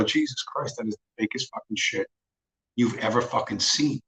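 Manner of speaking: hushed disbelief. Acted. Viewer reacting to the old moon video now, quiet disbelief. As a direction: drop the volume for an appalled reaction. Acted reaction to fake footage.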